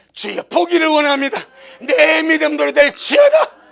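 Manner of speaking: blind man crying to be healed; loud plea. Retold or imitated. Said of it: "더 고함을 지르면서".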